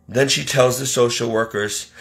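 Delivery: normal talking voice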